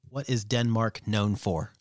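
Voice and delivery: slow, evenly spaced question; talking to Siri. Real, not acted. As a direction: enunciate it word by word for a voice assistant.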